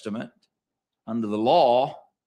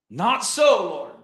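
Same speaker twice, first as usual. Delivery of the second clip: Peter refusing; 6 semitones higher, 4 dB louder, slower than usual. Acted.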